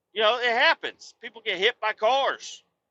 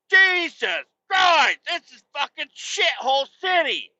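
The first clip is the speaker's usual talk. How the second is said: exasperated shout; 5 semitones higher than usual. Real, not acted. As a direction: curse the city at full volume. Closing rant.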